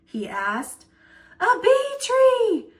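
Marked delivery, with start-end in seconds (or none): 1.4-2.7 high, loud, slow breathless cry; Mary Ellen calling out while running out of breath; gasped out mid-run; puffing. A narrator's reading. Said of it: "Mary Ellen puffed".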